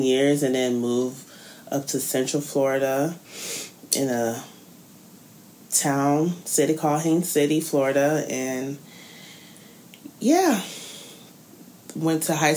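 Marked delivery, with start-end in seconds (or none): none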